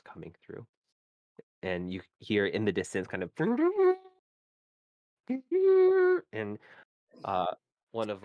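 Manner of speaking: scene-setting storyteller voice; game master narration. Acted. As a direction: set the scene for the players. GM continues scene description.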